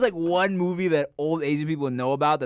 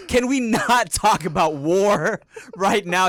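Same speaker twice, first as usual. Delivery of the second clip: mock pleading; 5 semitones higher, 5 dB louder, much slower than usual. Pleading with dad.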